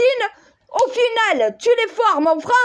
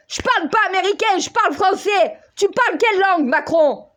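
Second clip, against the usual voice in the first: ordinary delivery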